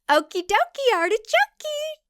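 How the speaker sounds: very high, sing-song chirp; bubbly ditzy girl; chirpy high voice. Acted. Jessica's chirpy reply.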